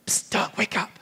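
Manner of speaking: hushed whisper, Doug wake up. Retold or imitated. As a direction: whisper urgently so nobody hears. Wife waking him at 4am.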